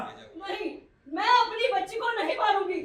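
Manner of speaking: soft, hurried, scared no; wife refusing abortion, afraid; frightened refusal. Acted. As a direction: plead quietly, protecting the child.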